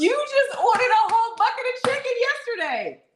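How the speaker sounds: high, loud incredulous protest; exasperated scolding. Real, not acted. Wife busting husband's healthy-eating claim, exasperated. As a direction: call out his lie, laughing and high-pitched.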